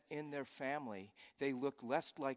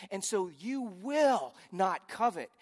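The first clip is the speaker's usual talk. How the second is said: slow, louder proclamation with stress on will; God's voice; 7 semitones higher, 12 dB louder, slower than usual. Acted. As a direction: announce it as God, slow and strong. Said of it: "says God".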